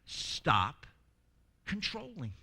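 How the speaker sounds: ordinary talk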